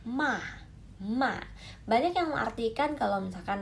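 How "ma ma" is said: "ma" with a decisive fall; falling fourth tone. Shown as a demonstration. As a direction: drop MA sharply downward. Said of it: "kita turun jadi".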